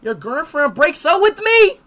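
high, loud, slow shocked yell; outraged disbelief. Real, not acted. Gamer outraged at game event.

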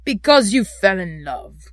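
loud, scornful growl; grumpy, mocking repeat. A narrator's reading. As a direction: echo it back with contempt. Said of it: "growled Scrooge".

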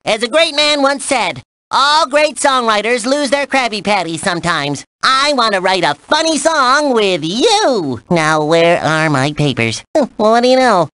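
perky, showy cartoon character; cartoon game host. Host briefs the player on writing a song, cartoon game host. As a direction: present it like a playful cartoon host.

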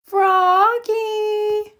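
called high across the yard; a mother calling her child from the door; long high call. A narrator's reading. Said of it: "called his mother".

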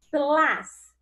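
tlas called out high and loud; syllable called out. Shown as a demonstration. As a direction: sound out tlas as one beat.

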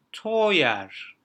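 "Teuer" loud and drawn out as "toyer". Shown as a demonstration. Teacher demonstrating E U, loud stretched oy. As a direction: say "Teuer" emphatically with a full "oy". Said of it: "oy diye".

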